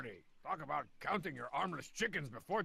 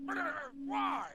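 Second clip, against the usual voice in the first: shocked outrage; 11 semitones higher than usual. Real, not acted. Indignant at not being invited.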